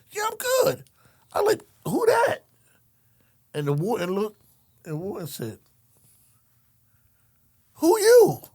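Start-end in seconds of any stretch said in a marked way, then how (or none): 0.1-2.4 mock high voice. Acting out exchange in high voice. 7.7-8.4 very high, loud startled warden; high, loud mock-shocked warden.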